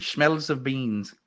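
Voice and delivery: slow, drawn-out mimicked Shakespearean accent; mock Shakespearean. Host echoing co-host's reading, slow mock Shakespearean accent. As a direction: stretch it out like a hammy Shakespearean.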